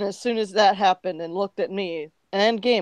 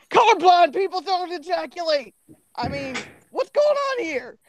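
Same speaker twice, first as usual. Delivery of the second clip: high-pitched, startled character voice; raised, panicky exclamation; alarmed outburst; 8 semitones higher than usual. Acted. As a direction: burst in with a high, alarmed cry. Character barrels into a commotion.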